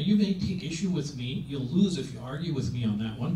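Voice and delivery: ordinary talk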